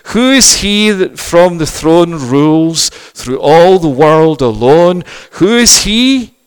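slow, rising, loud hymn recitation; recited hymn. Acted. Hymn recited at full force.

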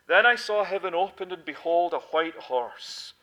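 loud, proclaiming scripture read. Acted. Preacher reading Revelation to the church, proclaiming loudly. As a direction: declaim it from the pulpit.

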